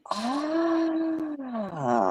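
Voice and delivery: drawn-out high "ahh" gliding down; gasp, then let out a long falling "ahh". Real, not acted. Reacts to the water business reveal.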